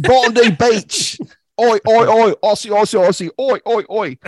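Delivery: high, hammed-up Australian cheer; Australian accent. Host showing how Australians cheer, in put-on Australian accent. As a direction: cheer like an Aussie crowd in broad Australian accent. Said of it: "one of my accents".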